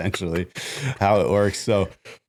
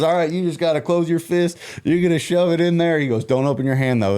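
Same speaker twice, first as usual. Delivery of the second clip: higher, louder urgent instructions; Jeff coaching; 10 semitones higher, 3 dB louder than usual. Retold or imitated. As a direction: bark the steps at a newbie.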